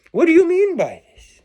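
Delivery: high-pitched mock listener voice; puzzled questioner. Listener interrupting the speaker with a question, high put-on voice.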